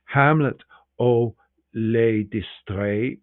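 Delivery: slow, drawn-out French title; French poster title. Retold or imitated. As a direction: spell out the French poster line slowly. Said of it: "graciously wrote tiny signs in air".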